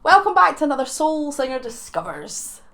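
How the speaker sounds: a light pirate growl on the welcome. Acted. Opening the show to viewers, lightly voiced as a pirate. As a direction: hams it up like a pirate captain. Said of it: "I said that like a pirate".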